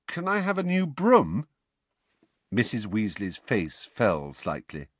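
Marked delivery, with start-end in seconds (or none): 0.1-1.5 put-on high, pleading boy's request; hopeful. A narrator's reading. Son asking mother for a new broom, hopeful. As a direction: ask with cautious hope, pitched up. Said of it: "said Ron hopefully".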